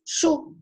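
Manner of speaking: chu said as the sound, not spelled; phoneme demo. Shown as a demonstration. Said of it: "na hora que eu vou pronunciar".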